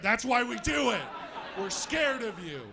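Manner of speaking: usual speaking voice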